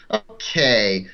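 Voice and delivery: usual speaking voice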